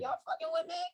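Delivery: very high, incredulous question; mock disbelief. Reacting to podcast success, high disbelieving question. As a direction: ask it like you can't believe it. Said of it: "I'm like".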